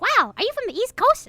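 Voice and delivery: very high mock-amazed voice; high-pitched imitation of wonder; imitating amazed people. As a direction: mimic a wowed fan up high. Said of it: "people are like".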